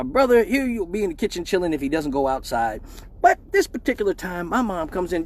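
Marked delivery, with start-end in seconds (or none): none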